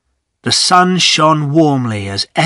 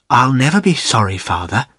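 plain reading voice

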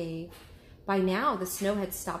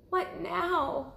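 usual speaking voice

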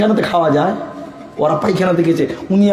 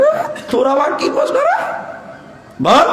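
high, teasing put-on voice; playful mock scold; 13 semitones higher than usual. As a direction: tease the boy from way up high. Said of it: "কপাল ঘষিয়ে বলছে".

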